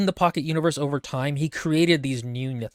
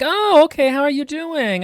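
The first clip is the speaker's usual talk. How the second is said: chirpy caregiver; 10 semitones higher, 4 dB louder than usual. Retold or imitated. Hospital staff greet Leith.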